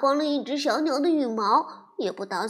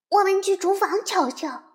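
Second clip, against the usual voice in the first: higher, louder call to the others; 7 semitones higher, 5 dB louder than usual. A narrator's reading. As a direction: call out eagerly. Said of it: "泰利喊道". (泰利大声喊道)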